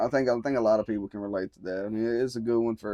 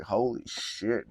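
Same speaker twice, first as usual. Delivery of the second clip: slow, drawn-out astonishment; retold amazement; much slower than usual. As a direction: stretch it out, retelling your amazement. Retold amazement at video count.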